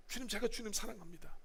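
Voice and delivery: quietly voiced Peter; soft reply. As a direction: answer softly as Peter. Peter answering Jesus softly.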